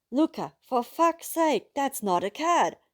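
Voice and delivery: high, loud, slow cry of disbelief; exasperated outburst. A narrator's reading. Narrator realizing Luca's pet is no cat, exasperated outburst. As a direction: yell it at him in horror.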